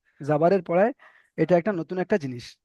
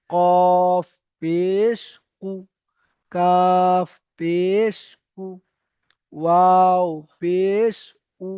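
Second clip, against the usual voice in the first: very slow, sustained letter-by-letter chant; drawn-out recitation; much slower than usual. Shown as a demonstration. Teacher models three-alif stretch for students, long held vowels. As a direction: stretch each letter name, pause before the syllable. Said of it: "এমনভাবে পড়তে হবে".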